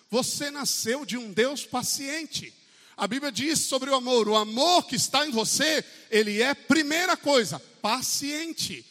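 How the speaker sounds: high, loud sermon climax; crying out on patience; impassioned preaching. Acted. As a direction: drive it home from the pulpit. Preaching builds.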